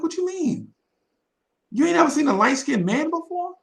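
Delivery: high-pitched retold disbelief; incredulous. Replying to the staring girls, high incredulous questioning. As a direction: question them high, as if baffled.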